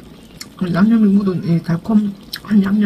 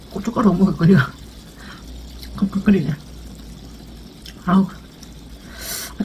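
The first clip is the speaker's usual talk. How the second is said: speaking between bites; talking while chewing. Real, not acted. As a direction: mouth partly full of food. Seen 4: lips pursed, jaw working between words; rib held only later.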